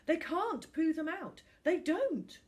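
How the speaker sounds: put-on high, loud, frantic child; insistent boy. A narrator's reading. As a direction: protest hard as a kid. Finn insistent.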